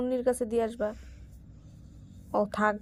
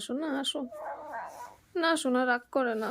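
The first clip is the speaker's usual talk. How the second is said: soothing; 5 dB quieter than usual. Real, not acted. Mother soothing her crying toddler, soft and coaxing. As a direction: hush him gently, coaxing him over.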